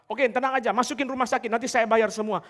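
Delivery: boastful promise. Acted. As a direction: play the big-shot who pays for everything. Quoting boastful would-be savior. (si penolong sok pahlawan menenangkan dengan suara keras)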